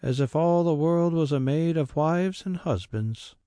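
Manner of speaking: a maid assuring her master; servant Mary. A narrator's reading. Mary assures the squire, high eager voice.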